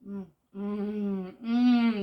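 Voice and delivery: savouring hum; appreciative mm. Real, not acted. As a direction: hum with pleasure at the taste. Savouring a tasty snack.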